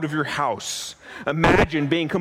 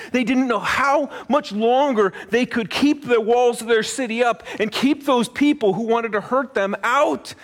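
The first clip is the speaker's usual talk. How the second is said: ordinary delivery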